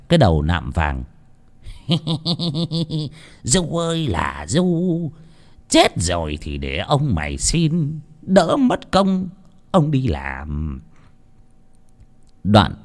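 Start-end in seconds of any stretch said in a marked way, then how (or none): 1.7-3.1 soft sly chuckle; quiet gloating chuckle. A narrator's reading. Old master alone with the cane, soft gloating chuckle. 3.4-10.8 slow, drawn-out mocking sigh; gloating. A narrator's reading. Old master gloating over his dead rival, slow mockery.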